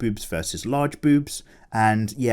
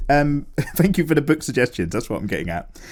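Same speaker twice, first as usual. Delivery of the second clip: ordinary talk, nothing marked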